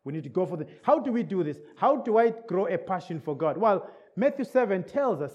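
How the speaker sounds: usual speaking voice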